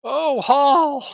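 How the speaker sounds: loud high near-miss cry; excited groan. Real, not acted.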